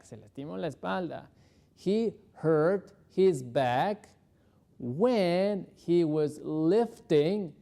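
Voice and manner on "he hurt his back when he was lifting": slow model sentence. Shown as a demonstration.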